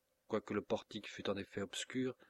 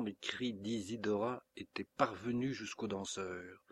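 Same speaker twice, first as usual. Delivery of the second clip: ordinary delivery, nothing marked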